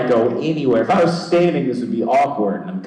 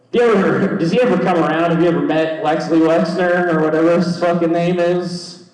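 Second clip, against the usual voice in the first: loud mocking question; 9 semitones higher, 5 dB louder than usual. Real, not acted. Loudly mocking the owner's name.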